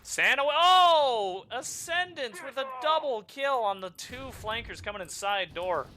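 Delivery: loud, high, stretched-out call of the play; real live shout of excitement; hyped caster shout. Real, not acted. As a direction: yell it out as the double kill lands. Caster calls a double kill.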